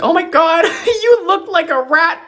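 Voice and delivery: very high, laughing mockery; laughing mean girl. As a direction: cackle it out high. Mocking laughing bully.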